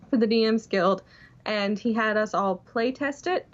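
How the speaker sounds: normal talking voice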